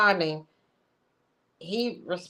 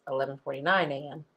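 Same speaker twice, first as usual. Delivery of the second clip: normal talking voice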